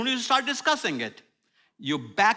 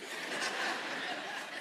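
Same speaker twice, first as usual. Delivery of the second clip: breathy laugh; 14 dB quieter than usual. Real, not acted. Laugh after mock apology.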